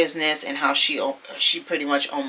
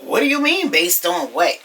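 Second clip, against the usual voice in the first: high-pitched mimicked indignation, dropping after the start; mock snippy comeback; 4 semitones higher than usual. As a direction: imitate someone getting snippy. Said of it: "Britt got all snippy with her".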